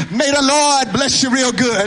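ordinary delivery

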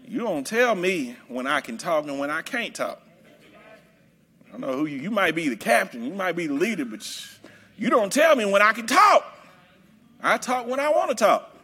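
low, soft mocking back-talk; mild mock attitude of a stubborn churchgoer; sassy defiant congregant. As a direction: play the grown person who won't be told. Some people with some attitudes.

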